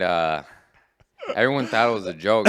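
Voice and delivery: ordinary talk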